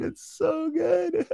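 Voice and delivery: drawn-out high squeal; slow, stretched delighted yell; gleeful shout. Real, not acted. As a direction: shriek it out in delight. Laughing over a clip.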